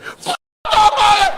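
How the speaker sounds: overdone shout "F- the police." mocking a fan; mock yell. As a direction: ham up an over-the-top yell of "F- the police.". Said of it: "Some people were shaking in there and said".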